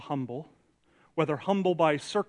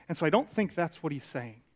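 usual speaking voice

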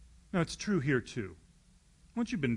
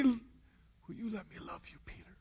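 whispered plea; 7 semitones higher, 4 dB quieter than usual. Acted. Jesus softly asking Peter to accept his love, whispering. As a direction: drop to a whisper, begging gently.

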